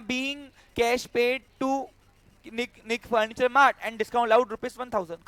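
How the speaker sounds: slow, measured reading for note-taking; slow dictation. Real, not acted. Teacher dictating a journal entry to students, slow dictation. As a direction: dictate it slowly, phrase by phrase.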